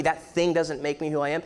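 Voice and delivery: ordinary talk, nothing marked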